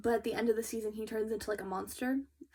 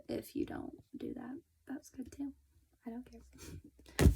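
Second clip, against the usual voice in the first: whispered aside; 2 semitones higher, 4 dB quieter than usual. Real, not acted. Mock-indifferent aside to viewers.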